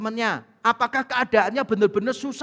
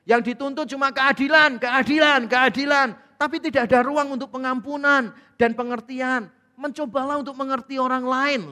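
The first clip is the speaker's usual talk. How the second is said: higher, forceful pulpit delivery; emphatic preaching; 5 semitones higher, 5 dB louder than usual. Real, not acted. Preacher urging the congregation to understand others, forceful preaching. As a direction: preach it hard, pressing each point. Preacher drives the point home.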